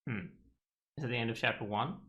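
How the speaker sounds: usual speaking voice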